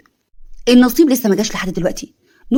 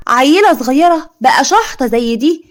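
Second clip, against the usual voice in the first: high, slow, drawn-out scoff; scornful disbelief; 3 semitones higher, 3 dB louder, slower than usual. Acted. Aunt mocks the father calling his grown daughter a child, slow scornful scoff. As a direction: sneer at the word little girl.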